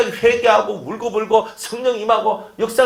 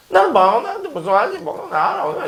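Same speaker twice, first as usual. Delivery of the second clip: mocking a believer; 9 semitones higher than usual. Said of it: "이거 하고 있는 거예요".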